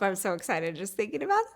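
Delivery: ordinary delivery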